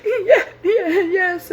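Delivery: very high, teary laugh on the words; real crying-laugh while brushing it off; answering a worried coworker through tears. Real, not acted. Crying at the office, reassuring coworker.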